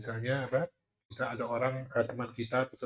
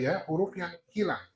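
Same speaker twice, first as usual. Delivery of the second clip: ordinary delivery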